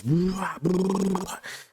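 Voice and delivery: high, rolling gun-burst noise; imitated gunfire. Streamer acting out a man firing on officers, imitated gunfire. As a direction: mimic automatic gunfire with the mouth. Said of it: "They turn around, he goes".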